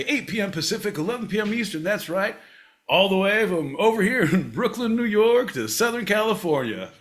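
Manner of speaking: showy show-host intro; announcer voice. Acted. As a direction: welcome the crowd like a ringmaster. Podcast intro announcer bit.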